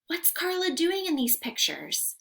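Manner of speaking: usual speaking voice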